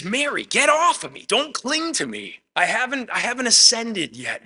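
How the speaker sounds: high, strained voice of Jesus telling Mary to let go; Jesus pushing her off. Retold or imitated. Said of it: "And he says".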